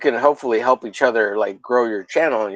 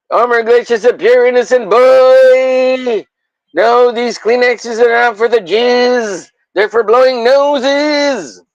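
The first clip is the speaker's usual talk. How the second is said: much higher, louder, slow put-on voice; mock announcer; 9 semitones higher, 5 dB louder, slower than usual. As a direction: proclaim it like a grand announcer.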